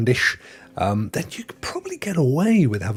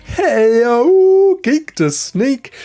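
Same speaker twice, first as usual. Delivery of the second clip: excited greeting; 13 semitones higher, 7 dB louder, much slower than usual. Real, not acted. Greeting a chatter.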